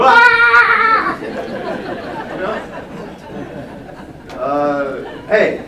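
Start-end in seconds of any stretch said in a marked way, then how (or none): 0.0-1.2 high loud game-show shriek then laugh; excited scream. Retold or imitated. Game show winner shrieking with joy, high and loud. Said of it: "you go". 1.3-4.3 high loud game-show shriek then laugh; excited scream. Retold or imitated. Game show winner shrieking with joy, high and loud. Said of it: "you go".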